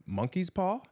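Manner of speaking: put-on high, questioning boyish lift; curious. A narrator's reading. Boy asking his uncle about the paw, curious. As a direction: ask it with piqued interest. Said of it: "said the boy curiously".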